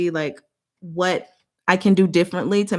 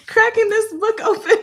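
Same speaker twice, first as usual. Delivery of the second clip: very high, squeezed by laughter; real giggles; 3 dB louder than usual. Real, not acted. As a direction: finish the sentence while cracking up. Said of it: "I am so giggly right now".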